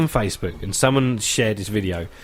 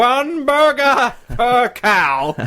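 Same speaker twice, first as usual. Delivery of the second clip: a hammed-up American Trump accent, higher and louder, drawn out very slowly; mock Trump accent, slow and grand; Donald Trump impression; 5 semitones higher, 3 dB louder, slower than usual. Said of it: "That's a good accent".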